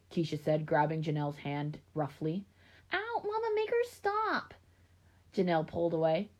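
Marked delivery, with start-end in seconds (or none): 2.9-4.5 put-on very high, squeaky little-girl wail; whining little child. A narrator's reading. Five-year-old complains to her mother about her sister, whining. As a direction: whine to Mama in a tiny high voice.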